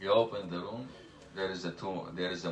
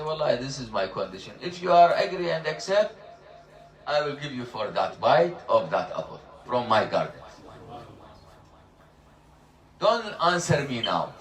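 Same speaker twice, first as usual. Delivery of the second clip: louder, insistent, laying down terms; firm raised voice; 4 semitones higher, 7 dB louder than usual. Retold or imitated. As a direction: speak up firmly, setting a condition. Father setting his condition.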